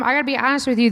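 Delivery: ordinary talk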